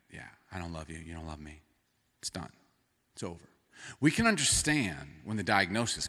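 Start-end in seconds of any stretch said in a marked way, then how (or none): 0.1-3.5 very soft, low, defeated; resigned hush. Retold or imitated. Spouse admitting the marriage is over, very soft resigned. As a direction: let it fall away like a tired spouse.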